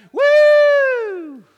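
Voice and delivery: high, loud mock cheering whoop; crowd whoop. Pastor mocks the cheering TV audience, loud whoop. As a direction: imitate a whooping studio crowd. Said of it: "And everybody goes".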